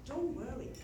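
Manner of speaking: ordinary talk, nothing marked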